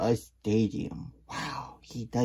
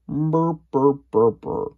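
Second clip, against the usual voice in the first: loud chirpy burr; 4 semitones higher, 9 dB louder than usual. Acted. Character chirping sound.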